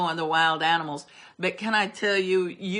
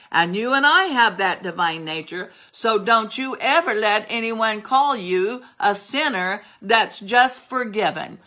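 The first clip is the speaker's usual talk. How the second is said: ordinary delivery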